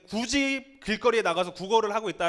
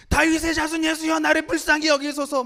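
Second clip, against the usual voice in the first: loud, high-pitched cry for help; sudden shout; 10 semitones higher, 8 dB louder than usual. Acted. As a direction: shout a plea over a crowd. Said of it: "갑자기 대뜸 소리 지릅니다".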